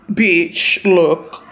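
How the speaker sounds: normal talking voice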